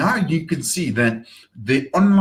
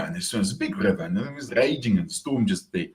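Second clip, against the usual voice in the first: usual speaking voice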